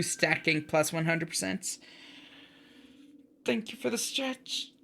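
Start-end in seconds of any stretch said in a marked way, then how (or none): 3.4-4.7 soft thank you. Real, not acted. Thanking viewer on stream.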